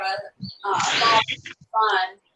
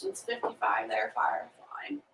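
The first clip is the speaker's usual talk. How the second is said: soft unvoiced whisper; 13 semitones lower, 7 dB quieter than usual. Real, not acted. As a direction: whisper it to one viewer. Soft aside to a viewer.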